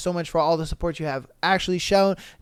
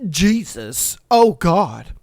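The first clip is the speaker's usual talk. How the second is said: mock disgust; 3 dB louder, slower than usual. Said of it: "Gets down there and he's like".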